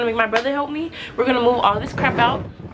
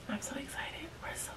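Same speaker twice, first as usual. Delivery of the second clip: whispering; 2 semitones lower, 15 dB quieter than usual. Real, not acted. Late night, others asleep.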